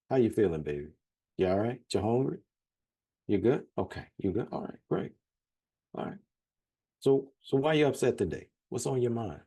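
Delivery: easygoing Southern check-in, asking after you; Louisiana folksy. Retold or imitated. Louisiana relative greeting someone at home, lightly voiced, friendly. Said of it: "if I go back home to Louisiana".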